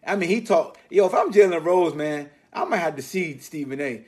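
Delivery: ordinary delivery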